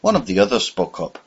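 ordinary delivery, nothing marked

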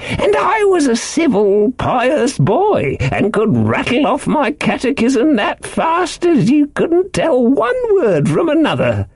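high, slow rambling sailor; eccentric castaway, earnestly pious. A narrator's reading. Ben Gunn's rambling tale.

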